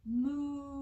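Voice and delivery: long, drawn-out moo; cow moo. Shown as a demonstration. Teacher shows kids what the cow says, long moo. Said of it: "What's the cow say?".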